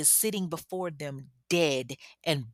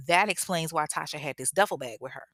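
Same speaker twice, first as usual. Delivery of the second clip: ordinary talk, nothing marked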